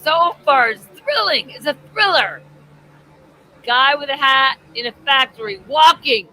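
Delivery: high, loud mock enthusiasm; sarcastic excitement. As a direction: overhype it sarcastically. Sarcastic hype over dull movie.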